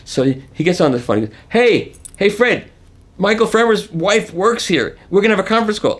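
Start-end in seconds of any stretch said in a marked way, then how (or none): 1.5-6.0 excited coworker. Retold or imitated. Coworker calling colleagues excitedly.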